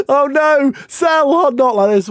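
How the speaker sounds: loud, high cry of alarm; dismayed shout. Real, not acted. Watching his keeper under pressure, alarmed shout. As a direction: yelp as the shot flies in.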